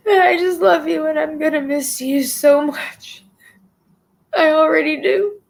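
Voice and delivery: crying, voice breaking high; sobbing through the words; tearful goodbye. Real, not acted. Live eulogy addressed to dying friend.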